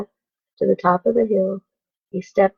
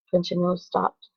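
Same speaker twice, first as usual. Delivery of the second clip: ordinary delivery, nothing marked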